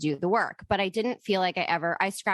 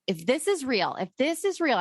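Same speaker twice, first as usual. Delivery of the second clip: rising higher on the repeat, pleading; building plea to the dead; 6 semitones higher than usual. Retold or imitated. Driving to yoga, daring her late boyfriend, rising plea. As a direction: push harder the second time, daring. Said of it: "And I'm like".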